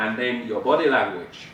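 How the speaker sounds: usual speaking voice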